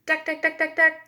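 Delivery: high, hammering duck duck; jackhammer. A narrator's reading. Teacher imitates men digging with a jackhammer, hammering. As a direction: bang it out like a jackhammer. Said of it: "It makes a loud banging sound".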